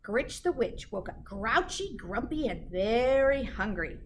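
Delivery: plain reading voice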